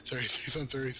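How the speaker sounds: ordinary delivery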